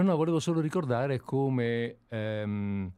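ordinary delivery

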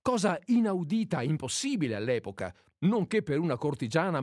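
normal talking voice

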